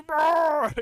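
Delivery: loud, high imitated creature roar; gremlin groan. Mocking the killer's stun noise, gremlin roar. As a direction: let out a big gremlin groan. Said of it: "a gremlin".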